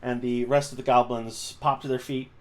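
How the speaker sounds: ordinary talk, nothing marked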